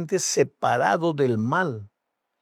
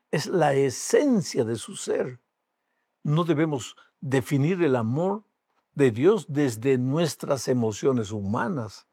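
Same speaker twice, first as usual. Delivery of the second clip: usual speaking voice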